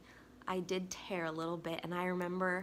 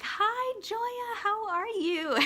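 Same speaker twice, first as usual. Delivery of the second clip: high, loud, drawn-out hello; sing-song delighted hello, pitched way up; cheery greeting; 7 dB louder, much slower than usual. Retold or imitated. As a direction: greet them with giddy, over-the-top cheer. Retelling her cheery greeting in labor.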